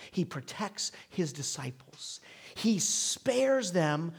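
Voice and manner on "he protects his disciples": whispered, slow conviction; slow hushed sermon whisper. Acted. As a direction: lean in and whisper it slowly.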